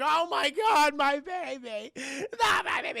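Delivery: mock grieving mom. Mom learns her son is dead, hammed-up high wail. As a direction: wail it like a soap mother. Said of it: "Mom's like".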